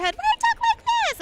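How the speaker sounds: very high, squeaky character voice; mocking a cute anime voice on Toonami. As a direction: squeak like a cutesy anime girl. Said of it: "Night of Monica going".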